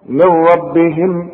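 "من ربهم" slow, noon silent, meem joined to raa; "من ربهم" merged as one flowing word; noon dropped. Shown as a demonstration. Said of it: "اسقط النون دي من النطق".

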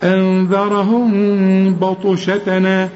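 slow أنذرهم بطشتنا, a long hum on the meem; hidden meem. Shown as a demonstration. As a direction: hold the nasal hum in أنذرهم before بطشتنا. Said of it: "هنا ما تقفلش شفايفك". (أنذرهم بطشتنا بإخفاء الميم)

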